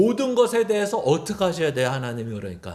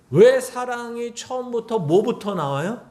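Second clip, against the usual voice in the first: ordinary delivery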